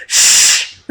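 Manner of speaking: a sharp, loud shushing hiss; imitating a loud shush. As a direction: shush someone hard and loud. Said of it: "really loudly".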